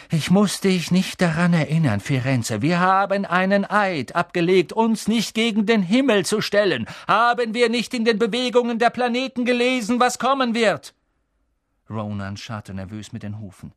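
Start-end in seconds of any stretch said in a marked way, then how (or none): 0.1-10.9 high, loud reminder of their oath; stern reproach. A narrator's reading. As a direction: lecture him angrily. Bane reproaching Firenze.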